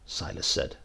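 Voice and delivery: usual speaking voice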